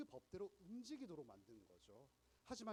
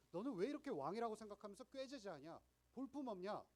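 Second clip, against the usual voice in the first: higher, louder scornful jeer; mocking Pilate; 6 semitones higher, 7 dB louder than usual. Pilate mocking Jesus as a shabby king, higher and louder jeer. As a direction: sneer at the shabby would-be king. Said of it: "이렇게 묻는 거죠".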